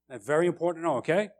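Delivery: usual speaking voice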